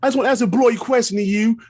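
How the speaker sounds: high-pitched, hammed-up British accent; terrible British accent. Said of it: "that's my terrible British accent".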